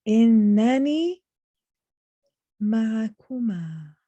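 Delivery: Innani loud and drawn out, ma'akuma slowly held; slow, emphatic Innani rising at the end; very strong emphasis. Shown as a demonstration. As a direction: lean hard into Innani, stretch ma'akuma. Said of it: "a very strong emphasis".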